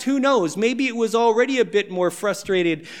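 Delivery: ordinary talk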